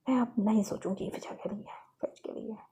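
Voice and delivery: hushed, fading vow to oneself; subdued resolve. Acted. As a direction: voice dropping away to a whisper. Lovely quietly resolves to stop caring.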